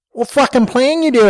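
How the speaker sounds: high, indignant bogan woman; high-voiced woman protesting to Jimbo in the car; Sheila's voice. A narrator's reading. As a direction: pitch up into Sheila, outraged. Sheila demanding an answer.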